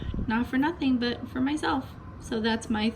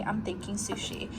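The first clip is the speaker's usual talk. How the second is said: whispered aside to herself; 9 dB quieter than usual. Real, not acted. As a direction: breathe the words out unvoiced.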